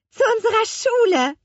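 put-on very high, eager child's cry; calling out. A narrator's reading. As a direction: call the destination out high and eagerly. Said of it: "rief Lea". (hell begeistert gerufen)

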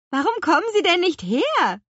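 high, loud puzzled question; wondering witch, voice pitched right up; puzzled calling. A narrator's reading. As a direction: call down in wonderment. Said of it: "wunderte sich die Apfelhexe".

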